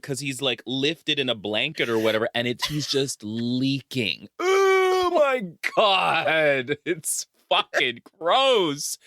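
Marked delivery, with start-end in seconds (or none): 4.4-9.0 high, slow, drawn-out disgust; disgusted cry. Real, not acted. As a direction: wail it in disgust. Reacting to gross shot.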